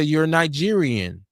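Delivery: usual speaking voice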